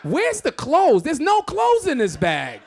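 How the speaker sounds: high, loud, slow and laughing; laughing exasperated dad; incredulous outrage. Retold or imitated. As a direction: drag it out in shocked disbelief.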